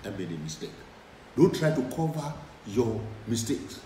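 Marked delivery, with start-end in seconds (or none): 0.0-0.7 soft, quiet, humble apology. Shown as a demonstration. Modelling for listeners how to apologise humbly. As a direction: own the mistake gently and quietly.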